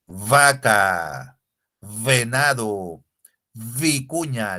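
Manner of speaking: "vaca, venado, vicuña" slowly, each starting on a long buzzing v; exaggerated labiodental V. Shown as a demonstration. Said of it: "la V suena así".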